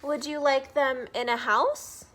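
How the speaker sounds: loud, coaxing question; Sam character voice. Acted. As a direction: tempt them cheerfully. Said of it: "read you guys Green Eggs and Ham".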